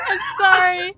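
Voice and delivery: high, giggling sorry; laughing apology. Real, not acted. Player apologizing to the cat Jess, laughing high. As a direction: apologize through helpless laughter.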